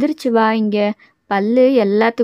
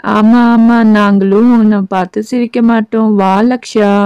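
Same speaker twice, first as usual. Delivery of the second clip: much louder, insistent family voice; loud coaxing; 7 dB louder than usual. Acted. Relative urging the sulking girl to come in, loud. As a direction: call her in firmly and loud.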